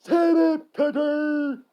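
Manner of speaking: high full-volume yell. Acted. Two skeletons silencing Cutter, yelling. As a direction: shout him down. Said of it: "Frank and Perry yelled".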